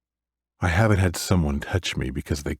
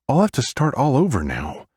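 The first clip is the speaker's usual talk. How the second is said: petulant; 9 semitones higher, 4 dB louder than usual. Acted. Sonny's petulant retort.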